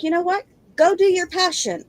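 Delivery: retold husband's encouragement. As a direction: pitch it up, imitating an encouraging husband. Husband's quote urging her on.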